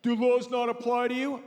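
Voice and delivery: asshole cop. Retold or imitated. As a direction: bark it like an arrogant cop. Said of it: "like an asshole".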